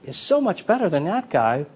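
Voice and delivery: mock the viewer who can't say why; impressed spectator. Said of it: "you go".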